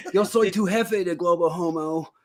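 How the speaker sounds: put-on high American-accented Spanish; George Bush. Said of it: "my George Bush in Spanish".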